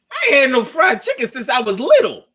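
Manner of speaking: high and laughing, a bit fast; gleeful over his mom's fried chicken; excited laughing quote. Retold or imitated. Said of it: "I said".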